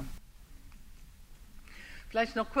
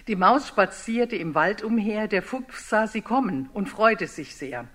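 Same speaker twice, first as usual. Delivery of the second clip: lively storyteller reading to a room, louder; story narration; 4 dB louder than usual. Acted. Speaker reads the Gryffalo story aloud to the audience, lively storyteller. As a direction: tell the picture book to the audience with gusto.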